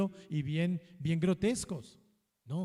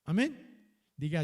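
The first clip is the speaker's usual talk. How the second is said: ordinary talk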